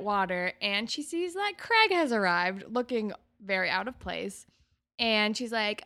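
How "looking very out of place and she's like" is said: ordinary talk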